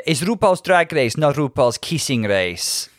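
playful impression of Yara's accent, slow; imitating Yara's accent. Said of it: "Sorry if that was an offensive accent".

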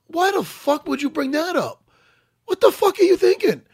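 high-pitched retold angry demand; indignant outburst. Fighter rebuking the ref before a fight, indignant. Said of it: "I said it just like that".

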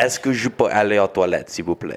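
playing at speaking French; fake French accent. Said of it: "let's all try to have fake accents".

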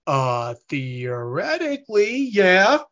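slow, drawn-out uncertainty rising at the end; retold tentative reply, stretched out; hesitant answer. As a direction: hedge warily, as if unsure it is safe. Wary answer to angry client.

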